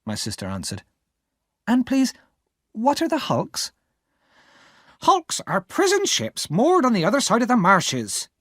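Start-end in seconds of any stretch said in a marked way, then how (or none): 1.7-3.7 put-on child's question. Pip asks. 5.0-8.3 impatient explaining. Acted. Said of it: "explained impatiently".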